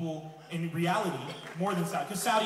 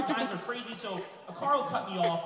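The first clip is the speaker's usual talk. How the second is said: ordinary talk, nothing marked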